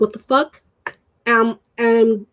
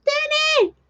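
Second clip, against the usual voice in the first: very high sung-out name; playful calling out; 3 dB quieter than usual. Acted. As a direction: holler for a friend in a high, drawn-out voice. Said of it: "I just like".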